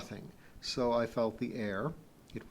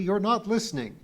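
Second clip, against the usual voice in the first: louder, higher sharp rebuke; 8 semitones higher, 6 dB louder than usual. A narrator's reading. Kip scolding Pee-wee for not listening, sharply. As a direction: scold sharply, pitch raised. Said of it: "I said sharply".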